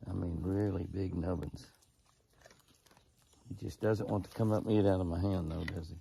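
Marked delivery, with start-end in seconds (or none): none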